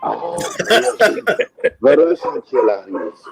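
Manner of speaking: slow, drawn-out mock Russian accent. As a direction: announce it like a ring name in a Russian accent. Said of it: "with that Russian voice".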